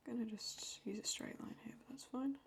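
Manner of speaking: whispered aside while drawing; hushed working whisper. Real, not acted. Drawing quietly, half to herself.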